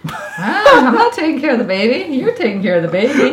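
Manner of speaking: laughing refusal. Real, not acted. Mother laughing, refusing baby duty.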